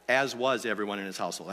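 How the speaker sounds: low, hurried reread of a fluffed line; rapid, low-pitched correction under the reading; quick self-correction. Real, not acted. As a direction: slip the corrected words in fast and low. Rereads the misread verse as correction.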